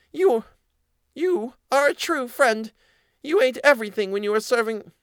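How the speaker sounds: squeaky mouse dragging out the accusation; accusing mouse voice. A narrator's reading. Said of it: "said the mouse".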